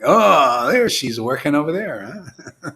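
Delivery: a loud Oh, then chuckling through it; laughing lookout. Pretend. The spying eye pointing out Maya, half laughing. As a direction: catch her and laugh as you point.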